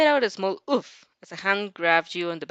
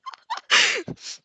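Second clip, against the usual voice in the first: high, harsh cackle; violent laugh. Acted. Shinso laughing at Monoma, harsh cackle. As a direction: burst into a wild cackle. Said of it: "unmistakable violent".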